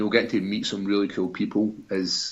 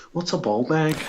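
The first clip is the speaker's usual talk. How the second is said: higher-pitched baffled question; mock-puzzled quote, rising at the end; puzzled questioner; 6 semitones higher than usual. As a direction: imitate someone asking, clueless. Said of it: "guys sit down to me like".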